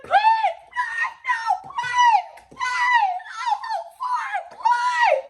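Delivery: imitating the thief screaming for mercy; shrieking plea. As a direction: scream please like you are terrified.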